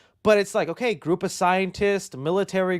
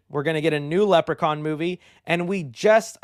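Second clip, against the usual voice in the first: ordinary talk, nothing marked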